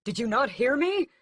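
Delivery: high, loud angry demand; furious loud challenge; cried out. A narrator's reading. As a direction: cry out at the guards. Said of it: "Raoul cries out".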